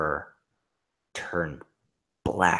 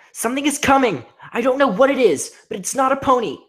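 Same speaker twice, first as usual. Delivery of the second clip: panicked; 12 dB louder than usual. Acted. Rainbow Dash warning in panic.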